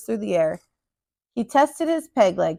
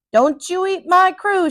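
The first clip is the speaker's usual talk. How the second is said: high, slow, louder defiant shout; 8 semitones higher, 4 dB louder than usual. A narrator's reading. Captain shouts at the giant to spare his crew, slow and defiant. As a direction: stand up to the giant with fists raised. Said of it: "shouted the captain".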